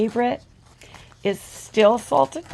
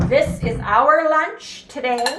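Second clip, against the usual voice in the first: usual speaking voice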